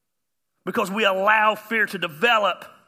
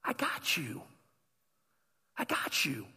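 soft, gentle reassurance; quiet, caring promise; tender; 6 semitones lower, 9 dB quieter than usual. Acted. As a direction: comfort them in a hushed voice. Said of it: "He's telling us tenderly".

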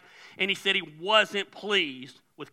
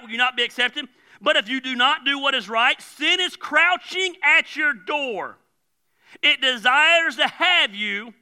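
God rebuking Cain; 6 semitones higher, 4 dB louder than usual. Retold or imitated. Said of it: "He's like".

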